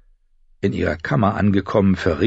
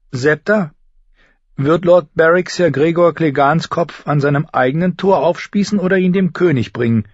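put-on high girlish, inquisitive voice; girl asking her governess; 9 semitones higher, 4 dB louder than usual. Girl questions the septa over breakfast, inquisitive. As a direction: pitch it up and ask like a curious girl.

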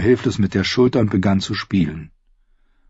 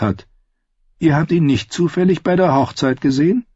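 higher-pitched, composed questioner; singer outlaw, inquiring; 8 semitones higher than usual. Acted. Singer questions the captive about a runaway, composed inquiry. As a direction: lay out the search as the outlaw singer.

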